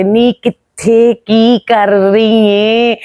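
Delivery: slow, drawn-out imitation of a moody star, lightly voiced; a playful take-off of her slow, lazy way of talking; imitating Babra Sharif. Mimicking how Babra Sharif talks when she is in a mood, slow and drawn out. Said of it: "इस type से ना वो बोलती हैं जब mood में होती हैं".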